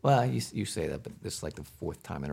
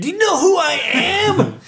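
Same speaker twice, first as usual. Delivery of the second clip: high, slow, outraged diva shout; indignant shout; 11 dB louder, slower than usual. Retold or imitated. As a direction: shout like an insulted star. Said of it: "I'm like".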